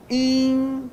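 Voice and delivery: "in" held very long, high and level; nasal vowel sound. Shown as a demonstration. Teacher showing the sound, "in" as a long high nasal. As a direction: stretch "in" as one steady nasal vowel. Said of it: "O som".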